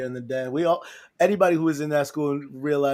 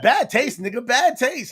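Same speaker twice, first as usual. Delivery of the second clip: high, louder mocking quote; imitated heckling; 9 semitones higher, 4 dB louder than usual. Imitating Al butting in as Joe hollers at a girl, mock heckling. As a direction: needle him in a raised, teasing voice. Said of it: "He's like".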